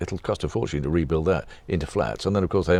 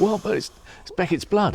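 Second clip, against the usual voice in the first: much higher, aghast protest; shocked objection; 12 semitones higher than usual. Retold or imitated. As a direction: squeak out the horror. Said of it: "The cleaner came in and said".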